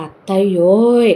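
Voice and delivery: stretched call across the house. Acted. Calling out to sister-in-law.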